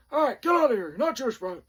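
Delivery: loud, high dismissal; drill sergeant voice. Retold or imitated. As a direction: shout the private out of the line. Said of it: "they all talk like this".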